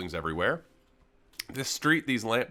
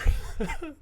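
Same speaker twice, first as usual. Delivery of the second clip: high laugh; 5 dB louder than usual. Real, not acted. Laughing at giant ornaments.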